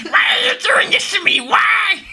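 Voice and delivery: shrieking high, loud whisper; high gremlin wail. Retold or imitated. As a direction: whine it like a wounded gremlin. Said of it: "sound like Reptile".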